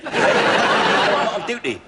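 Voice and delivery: slow, laughing through the words; can't hold the laugh in while lying. Real, not acted. Burglar laughs while posing as doctor.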